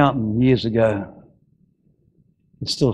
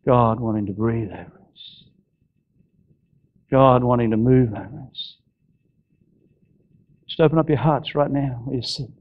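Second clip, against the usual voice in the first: prayerful close. Acted. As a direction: draw the church into prayer gently.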